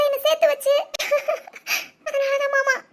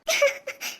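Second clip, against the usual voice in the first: stifled giggle; 3 semitones higher, 5 dB quieter than usual. Acted. Bride gloats over overheard gossip.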